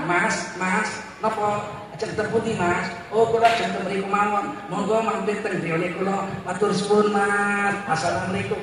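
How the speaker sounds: soft-spoken Javanese dialogue; soft Javanese accent. Shown as a demonstration. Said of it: "paling enak kalau pakai logat Jawa".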